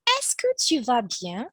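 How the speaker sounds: teacher reads the model question. Shown as a demonstration. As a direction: read out the model question brightly. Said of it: "which is".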